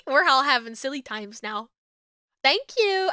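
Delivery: ordinary delivery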